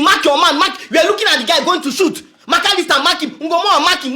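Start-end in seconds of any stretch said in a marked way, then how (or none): 0.0-0.8 shouting instructions at the screen, higher and louder; frantic fan yelling at a defender; retold yell at the TV. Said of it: "I was screaming at my T V". 2.5-4.1 retold yelling. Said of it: "I was screaming at my T V".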